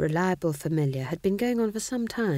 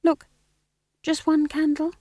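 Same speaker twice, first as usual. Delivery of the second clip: high, small-voiced plea; young witch coaxing; pleading; 13 semitones higher, 4 dB louder than usual. A narrator's reading. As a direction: beg gently in a high voice. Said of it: "a look of pleading".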